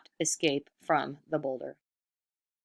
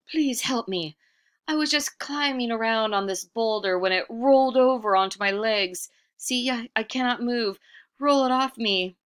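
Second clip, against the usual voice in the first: higher, louder wheedling plea; pleading trickster; 7 semitones higher, 6 dB louder than usual. Acted. Iktomi begs the buffaloes to free him, pleading. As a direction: beg the buffaloes sweetly for help. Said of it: "he said to the buffaloes".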